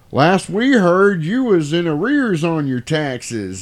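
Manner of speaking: slow, high southern drawl; southern yokel accent. Retold or imitated. Dude at the bar needling the complainer, slow southern accent. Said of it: "I love doing these southern yokel accents".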